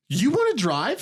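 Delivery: high-pitched, incredulous question; exasperated challenge. Retold or imitated. Driver to complaining passenger, incredulous challenge. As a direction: throw it back at them, voice jumping up.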